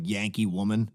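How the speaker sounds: ordinary delivery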